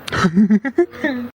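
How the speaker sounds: high, scornful burst of laughter; teasing cackle; mocking laugh. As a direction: laugh at her expense. Mocking wife's stinginess.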